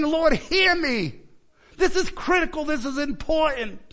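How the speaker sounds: high, urgent prayer cry; pleading cry. Acted. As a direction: cry out to heaven, pleading to be heard. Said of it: "You are yelling, you are screaming, you are pleading".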